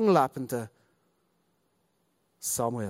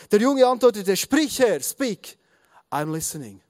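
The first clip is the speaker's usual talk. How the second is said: lively, raised storytelling read-aloud; animated Bible narration; 4 semitones higher than usual. A narrator's reading. Preacher reading of Samuel's third calling, animated narration. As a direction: read the story out with rising drama.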